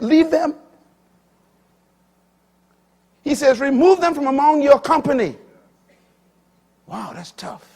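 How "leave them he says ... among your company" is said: high, loud pulpit proclamation; preaching. Acted. Preacher quoting Paul to the church, loud and high. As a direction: thunder Paul's command.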